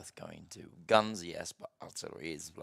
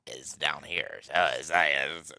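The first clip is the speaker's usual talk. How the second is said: low, louder ghoul voice; 3 semitones lower, 10 dB louder, slower than usual. Shown as a demonstration. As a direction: drop into a low ghoul register. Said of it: "if I do the ghoul voice".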